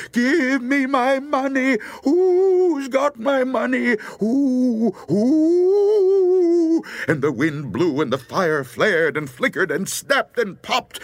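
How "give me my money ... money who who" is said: high, drawn-out ghost wail; eerie high howl for lost money; ghostly cry. A narrator's reading. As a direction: wail it high, building louder on the last word. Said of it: "way off a voice cried".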